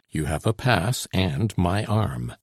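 usual speaking voice

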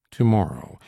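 ordinary delivery, nothing marked

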